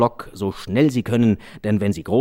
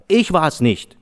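put-on higher, louder little boy insisting; boy protesting innocence; 6 semitones higher, 5 dB louder, slower than usual. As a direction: prove to mother it wasn't him. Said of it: "sagte der kleine Junge". (höher, lauter Junge beteuert Unschuld)

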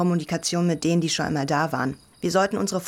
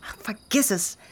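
higher, sharp indignant comeback; heated retort; 7 semitones higher than usual. Acted. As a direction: throw it back at her sister. Sisters arguing about melodrama.

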